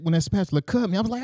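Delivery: ordinary talk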